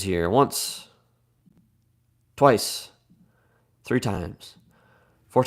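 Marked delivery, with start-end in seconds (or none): none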